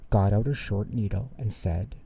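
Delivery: usual speaking voice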